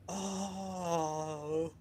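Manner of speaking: gasp, then a long drawn-out oh; acted shocked realisation. Recalling figuring it out at thirteen, mock-shocked gasp. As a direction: mimic the penny dropping.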